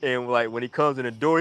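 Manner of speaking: ordinary talk, nothing marked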